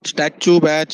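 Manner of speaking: loud call to freeze the batch. Real, not acted. Teacher ordering online students to freeze, loud call. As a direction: bark it so the whole class stops.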